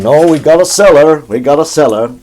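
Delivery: loud, firm fatherly refusal; mock stern Paul Walton voice. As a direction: put your foot down as the dad. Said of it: "Paul Walton's going".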